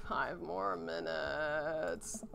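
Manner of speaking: very slow, soft, drowsy plea; sleepy groan. Pretend. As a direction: drag it out like you're half asleep.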